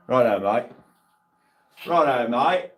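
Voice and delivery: lightly mock British mate greeting; British accent impression. Streamer teasing an English viewer, mock British accent. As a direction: put on a cheery British accent, rising at the end. Mimicking English slang to chat, then laughs.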